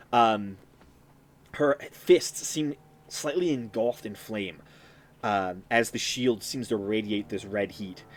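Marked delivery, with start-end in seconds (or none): none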